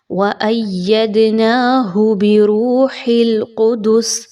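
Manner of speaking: slow chant, ح in بِرُوحِ heavy from throat, قُدُسِ with bounced د; tajweed demo. Shown as a demonstration. Teacher shows students the heavy ha. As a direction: recite بِرُوحِ الْقُدُسِ slowly with clear throat sounds. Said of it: "ہا اور ہا کا مخرج خاص واضح".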